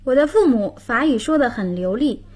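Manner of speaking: normal talking voice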